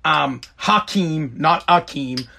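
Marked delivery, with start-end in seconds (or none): none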